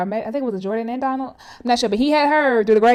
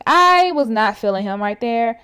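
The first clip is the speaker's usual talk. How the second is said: ordinary talk, nothing marked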